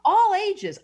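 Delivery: sudden loud high burst of joy; excited burst. Real, not acted. Astrologer gushing to the host about people reclaiming their light, excited.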